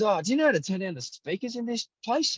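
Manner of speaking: put-on high, polite old bloke asking a favour; a fussy older spectator politely asking the commentator, in a high mock voice; older gentleman. Older gentleman asks again.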